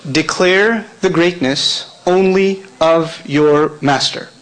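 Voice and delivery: very slow, each word spaced for note-takers; slow dictation. Shown as a demonstration. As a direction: dictate it slowly so they can write. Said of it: "write this in English".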